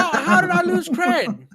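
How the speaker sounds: high, loud, drawn-out protest; indignant disbelief. Real, not acted. Protests losing nerd cred.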